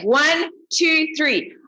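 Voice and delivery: excited countdown. Real, not acted. Countdown before group howl.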